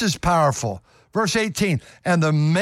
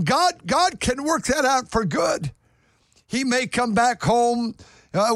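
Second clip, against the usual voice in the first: higher, pressing sermon voice; fervent preaching; 6 semitones higher than usual. Real, not acted. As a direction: lift the congregation with rising fervor. Preaches comfort at full force.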